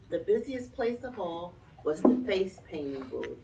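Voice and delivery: ordinary delivery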